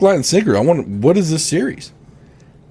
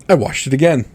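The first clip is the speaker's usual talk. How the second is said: normal talking voice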